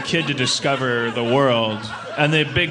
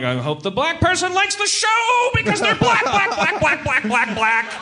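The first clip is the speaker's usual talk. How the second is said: high, loud frantic chant; anxious inner voice; 6 dB louder than usual. Retold or imitated. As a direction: chant it in a racing panic. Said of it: "I'm like".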